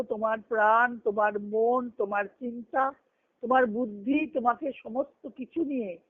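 usual speaking voice

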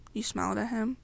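usual speaking voice

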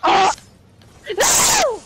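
shout. Real, not acted. Shouted in game panic.

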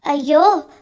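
loud, alarmed cry for help; narrated shout of dismay; calling out. A narrator's reading. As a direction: call them over urgently, raising the voice. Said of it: "ಎಂದು ಕೂಗಿದೆ".

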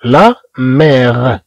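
لا مير stretched slowly; teacher slowly models the word; slowly sounded out. Shown as a demonstration. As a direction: draw out لا مير for learners. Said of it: "ثم عندنا".

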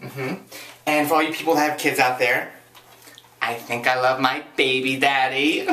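usual speaking voice

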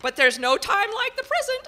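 very high, very loud triumphant line; high-pitched blast. Acted. Portrait shrieks the saying to the house. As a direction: shriek it out, delighted. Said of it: "she let out a high-pitched blast".

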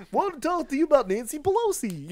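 high, goofy dinosaur voice; hammed-up cartoon phone voice; Barney. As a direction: greet a caller like a children's TV dinosaur. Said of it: "I sounded like Barney".

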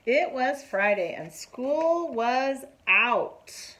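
slow, bright read-aloud voice; teacher reading a picture book to children; story narration. A narrator's reading. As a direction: read it out to the class, drawing it out.

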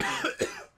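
choking cough; hacking cough, high; sharp high cough. Real, not acted. Coughing fit continues.

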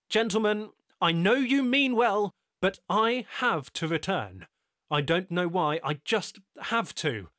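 loud, high, determined declaration; firm loud resolve. Acted. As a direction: insist and refuse to budge. Tyler declares his decision.

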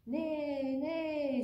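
high, drawn-out storybook horse neigh. A narrator's reading. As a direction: neigh like a horse for little listeners. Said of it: "said the horse".

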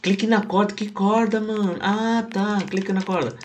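high, loud panicked yelling; frantic, near shrieking; being chased. Real, not acted. Still chased, frantic clicking.

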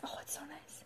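hushed delighted whisper; admiring whisper. Real, not acted. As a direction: breathe it out in delight. Admiring lips up close.

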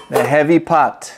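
ordinary talk

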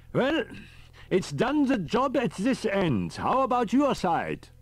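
put-on high, fussy elderly professor; a high old professor calling on the radio; professor's voice. A narrator's reading. Riker on the radio.

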